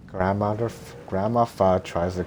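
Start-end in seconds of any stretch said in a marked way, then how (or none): none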